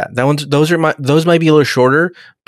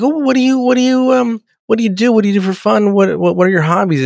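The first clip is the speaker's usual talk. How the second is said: higher-pitched voice of an eager date; acted date small talk; 9 semitones higher than usual. Date asking a Trekker about hobbies, high and chatty. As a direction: play the curious date making small talk. Acting out a date's questions.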